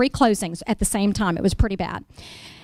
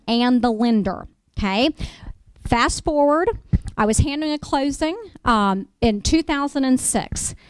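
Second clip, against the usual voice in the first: normal talking voice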